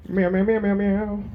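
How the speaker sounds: high playful meowing; cat meows. Pretend. Singer breaks into cat noises, high meows. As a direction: meow like a kitty mid-song. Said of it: "random animal noises".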